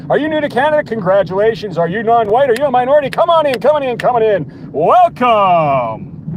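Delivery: loud, high hammed-up barker pitch; mock carnival barker. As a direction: sell the border like a fairground huckster.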